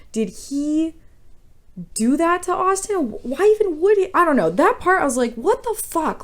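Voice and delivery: high-pitched, exasperated disbelief; worked-up, incredulous questioning; baffled exasperation. Real, not acted. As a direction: pitch climbing in bewildered protest. Baffled rant over unexplained plot detail.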